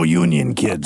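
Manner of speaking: very low put-on sign-off; deep jokey pronouncement; mock slogan voice.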